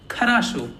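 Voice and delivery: "Kharasho" with light first vowels, strong final O; stress on last O. Shown as a demonstration. Teacher showing the stress rule, last O stressed in "Kharasho". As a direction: land firmly on "sho" in "Kharasho". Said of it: "only the last O is stressed".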